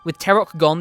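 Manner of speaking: ordinary delivery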